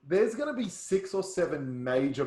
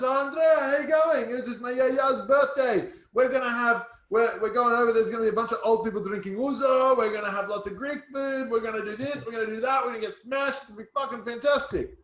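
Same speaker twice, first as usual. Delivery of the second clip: much higher and louder, hyped up; excited party invite; 8 semitones higher, 5 dB louder than usual. Retold or imitated. Friend hyping his yaya's birthday party, excited pitch. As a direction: sell the party with wild enthusiasm. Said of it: "Nick calls me and he goes".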